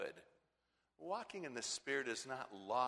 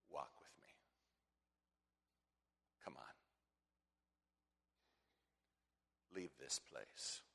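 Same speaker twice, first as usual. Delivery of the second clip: gentle whisper; 10 semitones lower, 13 dB quieter than usual. Acted. Preacher voicing Christ's call in a soft whisper. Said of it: "It is quiet".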